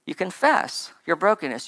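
ordinary talk

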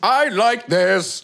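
drawn-out verdict. Shown as a demonstration. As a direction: drag out "I like this" as the set answer. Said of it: "it needs to be like this".

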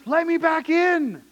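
a voice of God calling to a church that shut him out; Jesus pleading with the church. Acted. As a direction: cry out to them to be let back in. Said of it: "He's saying to the church".

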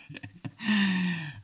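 high-pitched laugh. Real, not acted. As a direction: burst of amusement at one's own line. Laughs at own model reply.

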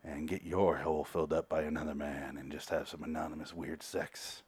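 low, hushed, suggestive murmur; seductive come-on. Retold or imitated. Tate coming on to Pat, softly seductive. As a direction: proposition him quietly and low. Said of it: "really coming on to him".

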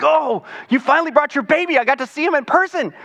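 put-on high, delighted squeal at a baby; excited greeting. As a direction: gush over the new baby like a thrilled friend. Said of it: "and I'm like".

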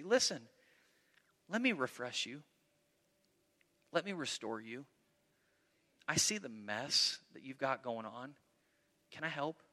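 soft, hushed, kindly offer of help; gentle restoring offer. Retold or imitated. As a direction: speak low and tender, as if coaxing a hurt friend. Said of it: "Do it gently".

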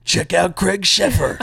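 slow hammed-up streaming promo; mock sales pitch, drawn out; cheesy blurb voice. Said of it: "I guarantee that one from Nightbreed is".

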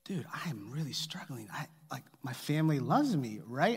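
hushed, breathy whispered confession; whispered plea. Retold or imitated. Dad whispering his self-doubt to his son, pleading. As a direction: whisper it, half-joking despair. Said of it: "I'm literally going like".

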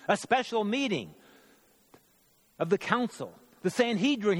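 crying out. A narrator's reading. Winded messenger summons the council at night, crying out. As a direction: call out urgent news in a lifted voice. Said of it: "he cried".

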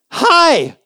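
high, loud bright greeting; cheery hi. Acted. Jesus greeting the women, high and cheery. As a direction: greet them like it's a great day.

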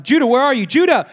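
dad calling through the house. Retold or imitated. Father calls son who is upstairs.

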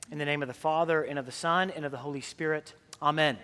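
soft, intoned invocation that fades at the end; liturgical blessing. Acted. Priest blessing the congregation before the sermon, soft and intoned. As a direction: bless the congregation quietly before sitting them.

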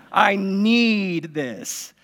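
"I need this" stretched very slowly, "need" drawn out; dragged desperate child's whine on "need"; drawn-out plea. Retold or imitated. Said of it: "kids are like".

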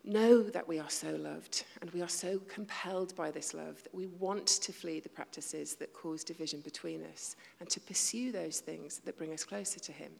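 ordinary talk, nothing marked

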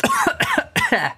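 coughing fit from a forced voice; voice-strain cough. Acted. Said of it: "Giant has emphysema".

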